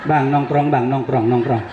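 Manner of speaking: low, teasing wifely coax; a playful wife ordering her husband in a low voice. Retold or imitated. Wife directing husband in bathroom.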